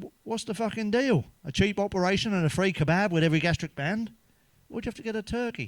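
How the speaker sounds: high, exasperated ranting; indignant rant. Retold or imitated. Husband rants at wife over Turkey surgery, high and exasperated. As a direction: let the outrage spill out.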